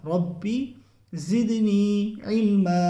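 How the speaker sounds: very slow, melodic recitation. Shown as a demonstration. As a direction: recite it slowly, ain from mid-throat. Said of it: "حلق کے درمیانی حصے سے نکلے گا". (آہستہ ترتیل سے تلاوت)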